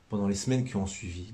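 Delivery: ordinary delivery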